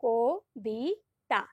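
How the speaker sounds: ক, বি, তা each said alone with pauses; syllables apart. Shown as a demonstration. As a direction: break কবিতা into its three syllables. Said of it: "আলাদা আলাদা উচ্চারণ করছি দেখো". (অক্ষর আলাদা করে বলা)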